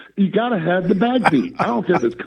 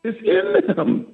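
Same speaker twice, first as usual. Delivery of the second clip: laughing; 13 semitones higher, slower than usual. Real, not acted. Laughing emphatic point.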